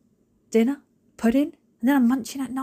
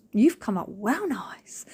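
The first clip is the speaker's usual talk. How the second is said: whispered compliment; slower than usual. Pretend. Praising her polished letterbox as a mirror, slow whisper. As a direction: admire it in a slow hush.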